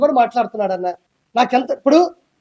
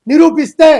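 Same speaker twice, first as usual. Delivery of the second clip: loud challenging burst; defiant shout; 5 semitones higher, 9 dB louder, slower than usual. Real, not acted. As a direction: throw down the dare loudly. Daring critics in heated challenge. (సవాలు విసురుతూ గట్టిగా అరుపు)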